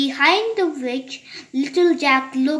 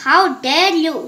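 louder, indignant storybook outburst; mildly acted screech of outrage; angry screech; 3 semitones higher, 6 dB louder than usual. A narrator's reading. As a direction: read it out loud and cross. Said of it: "screeched the very angry sounding voice".

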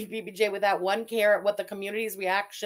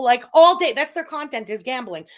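exasperated outburst; 8 dB louder than usual. Real, not acted. Ranting on stream about gambling streamers, loud exasperated outburst. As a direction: blow up at the streamers.

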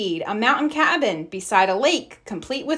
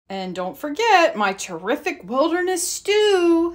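Papa Bear voice; 7 semitones higher than usual. A narrator's reading. Papa Bear's voice in story.

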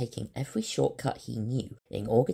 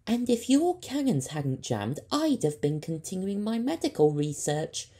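high, louder scolding student voice; annoyed Leica; 10 semitones higher, 7 dB louder than usual. Acted. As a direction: pitch up and scold him. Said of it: "annoyed that her research had been interrupted".

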